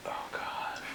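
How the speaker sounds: breathless whispered dread. Retold or imitated. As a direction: whisper it like you're about to be caught. Dreading the cop's question.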